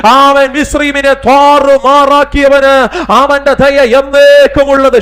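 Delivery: high, loud, drawn-out revival preaching; full-force preaching. Real, not acted. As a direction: roar the call and response to the church.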